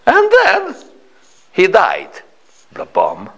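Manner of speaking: imitated Slavic grandmother, high opening then dropping; comic Slavic-accented grandmother; thick Slavic accent. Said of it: "in her thick Slavic accent".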